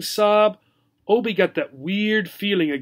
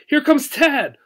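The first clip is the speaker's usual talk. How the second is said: higher, brighter call; happy anticipation; eager; 9 semitones higher, 4 dB louder than usual. Acted. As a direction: gerbil sure help has come. Gerbil eagerly expecting Tad.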